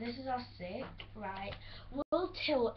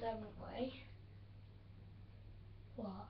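ordinary delivery

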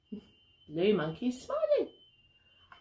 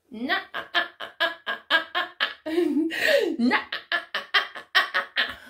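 teasing, staccato telling-off; playful no-you-don't; scolding blue monkey; 2 semitones higher, much faster than usual. Acted. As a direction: chop it into a string of little "ah"s. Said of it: "Red Monkey's been told off".

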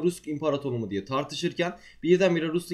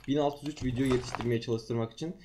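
usual speaking voice

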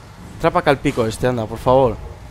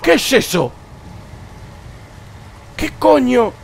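high startled cry; startled shriek; 10 semitones higher than usual. Real, not acted. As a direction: yelp it out in fright. Sudden scare in horror game.

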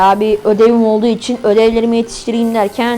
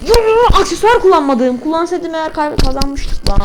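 excited protest; 6 semitones higher than usual. Real, not acted. Excited protest after match.

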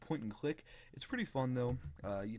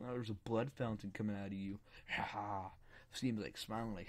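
normal talking voice

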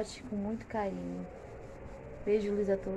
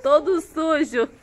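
laughing; 7 semitones higher, 12 dB louder, slower than usual. Real, not acted. Laughing at dirty puppies.

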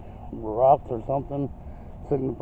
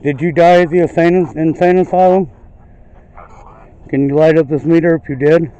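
loud, raised interrogation of the unseen; calling out to spirits; 5 semitones higher, 10 dB louder than usual. Real, not acted. Ghost hunter questioning a spirit about its death, calling out loudly. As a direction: project each question firmly outward.

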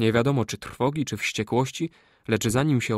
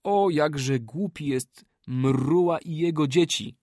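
higher, slow, jeering call; shouted mockery; 4 semitones higher than usual. A narrator's reading. Kali jeering at Mruła and his people, shouted. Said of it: "krzyknął Kali".

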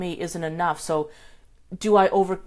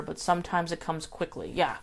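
ordinary talk

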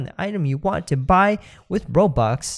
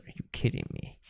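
whispered disbelief; 13 dB quieter than usual. Real, not acted. Muttered disbelief.

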